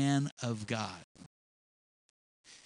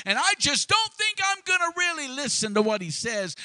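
sceptic mocked; 9 semitones higher, 4 dB louder than usual. Mocking a doubter.

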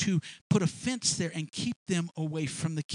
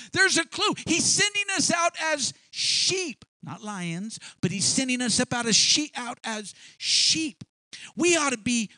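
raised, emphatic sermon delivery; forceful preaching; 6 semitones higher, 5 dB louder than usual. Real, not acted. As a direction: drive each point home to the congregation.

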